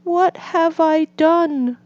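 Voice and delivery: high, distressed Toad; anxious cry. A narrator's reading. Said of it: "cried Toad".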